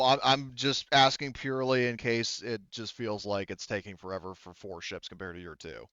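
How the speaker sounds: normal talking voice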